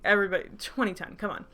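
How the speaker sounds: ordinary talk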